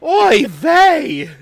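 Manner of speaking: loud high Yiddish exclamation; Yiddish accent. Acted. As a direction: cry out like a kvetching grandma.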